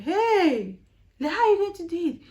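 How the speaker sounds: high, fast, loud alarmed cry; surprised colleague. Retold or imitated. Colleague hears of her Canadian interview, shrieks in surprise. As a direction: gasp out the shock of a colleague hearing the news. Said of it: "I was like".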